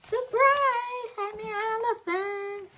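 very high, sung-out comic elephant reveal; silly surprise voice. Acted. Duckling revealing itself as elephant, squeaky sing-song voice. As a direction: pop out with a goofy high surprise.

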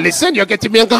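hammed-up cross French accent; angry French accent. French barista losing his temper, mock-angry French accent. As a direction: threaten like an unscary angry Frenchman. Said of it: "seen a French guy get angry".

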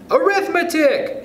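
very high, drawn-out shout; children's shout. A narrator's reading. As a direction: shout it like a whole class of kids. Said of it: "shouted the children".

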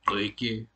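GOIKE with a rough, throaty first vowel; strident vowel. Shown as a demonstration. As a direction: constrict the glottis on the OI of GOIKE. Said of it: "another word with a, s-, a strident vowel".

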